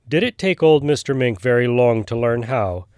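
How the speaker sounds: usual speaking voice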